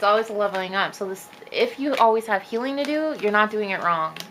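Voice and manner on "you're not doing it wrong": ordinary talk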